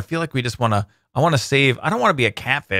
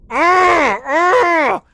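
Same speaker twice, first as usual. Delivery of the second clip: effort grunts; 12 semitones higher, 4 dB louder than usual. Acted. Catfish attack grunts.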